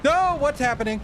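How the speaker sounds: loud, very high startled cry; real panicked shout; scared outburst. Real, not acted. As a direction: yelp it in sudden alarm.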